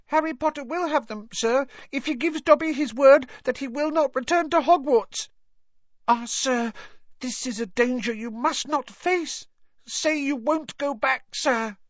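very high elf squeak, imploring; Dobby, urgent bargaining. Acted. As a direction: beg him desperately as a little elf.

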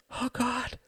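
high, anguished cry. Acted. Catherine crying out on seeing the fire. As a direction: cry out to God, high and breaking. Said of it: "cried Catherine".

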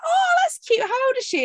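high, loud cooing voice; mock people cooing. People cooing at the younger sister, high and sing-song. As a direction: coo like a stranger at a baby. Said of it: "people always like".

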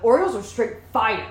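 loud, high-pitched hype; imitating brother. Stoned sister praises sandwich cookies, loudly talking like brother. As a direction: proclaim it like your excitable brother. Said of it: "You sounded just like your brother".

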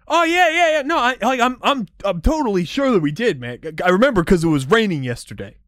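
stoner bros. Acted. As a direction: freak out like a clueless stoner. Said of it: "will be like".

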